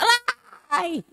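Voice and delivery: a sudden high-pitched yelp of pain; yelped ouch. Retold or imitated. Boy yelps after the engkanto circumcised him. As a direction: cry out high like a hurt little boy. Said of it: "ni singgit siya".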